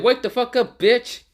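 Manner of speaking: high, loud furious shout, ending on a rise; angry yell. Acted. Naruto yelling at Gaara after smacking him, high and loud. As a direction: scream him awake again. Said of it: "so hard that he said".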